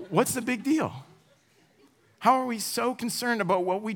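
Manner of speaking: high exasperated outburst. Retold or imitated. Preacher retelling his frustration in the drive-thru, exasperated. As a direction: throw up your hands at it.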